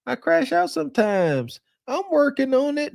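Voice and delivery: imitating her with sass and a little pride; sassy and proud. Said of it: "you saying it with sass".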